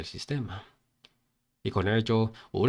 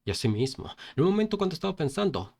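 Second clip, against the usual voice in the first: usual speaking voice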